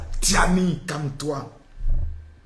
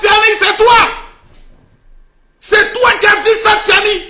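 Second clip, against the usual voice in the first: high, loud mock accusation; accusing shout; 12 semitones higher, 5 dB louder than usual. Host accusing Tiani after the speech clip, loud shout. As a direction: point the finger and yell.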